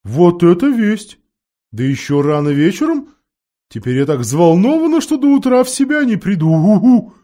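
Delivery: high, loud, fluttering owl voice; excited owl. A narrator's reading. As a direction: gush like a thrilled old owl.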